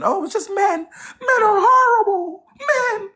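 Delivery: very high, slow, fake-crying mockery; mock whiny crying. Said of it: "I think a lot of y'all be thinking".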